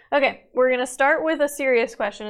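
ordinary talk, nothing marked

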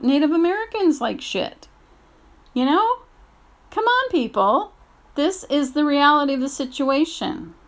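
angry rant. Real, not acted. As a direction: plead with people in real anger. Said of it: "I'm just really angry".

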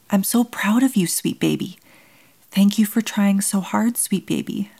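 put-on high, tearful words to a dying baby; crying tenderly. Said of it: "I cried".

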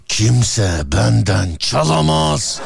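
slow, drawn-out tough-guy quote; Süleyman Çakır. Retold or imitated. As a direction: drag it out like a movie hard man. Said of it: "Süleyman Çakır".

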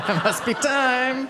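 high, slow, laughing; laughing quip. Retold or imitated. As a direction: say it through a laugh. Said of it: "so I was like".

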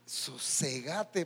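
slow, gentle calming of the soul; soothing command. Pretend. Preacher hushing his troubled soul, slow and gentle. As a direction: ease the soul down slowly.

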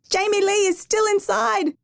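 high, panicked cry of a terrified mother; mother screaming for her trapped daughter; frantic scream. A narrator's reading. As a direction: cry out in terror for the child. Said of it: "she screamed".

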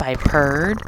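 ordinary delivery, nothing marked

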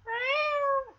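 high, long imitated cat meow; Sassy. Cat calling after the dogs, high mock meow. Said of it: "And she's like".

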